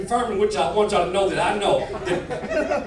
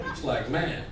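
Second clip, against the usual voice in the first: usual speaking voice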